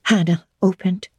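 ordinary delivery, nothing marked

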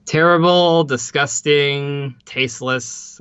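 slow, deliberate list, each word set apart; drawn-out condemnation. Real, not acted. Host condemning the band's joke about the Vegas shooting, slow and deliberate.

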